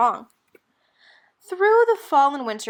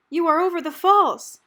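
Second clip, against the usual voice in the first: louder, higher rescuer breaking the news; 6 semitones higher, 7 dB louder than usual. Acted. The rescuer tells Annie where she is, raised voice. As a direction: announce it to her plainly but firmly.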